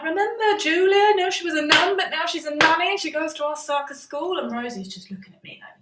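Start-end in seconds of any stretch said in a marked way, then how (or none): 0.0-4.4 high, loud rushed cover-up; frantic cover story. Retold or imitated. Comic signals wide-eyed to Rosie to play along, high frantic cover-up. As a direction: cover the lie with frantic brightness. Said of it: "I'm like".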